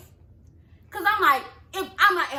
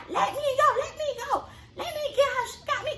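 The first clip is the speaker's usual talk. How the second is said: high, frantic mock plea without real volume; imitated yelling; 12 semitones higher than usual. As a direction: pitch it way up, imitating someone struggling to get free. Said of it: "all that screaming and yelling".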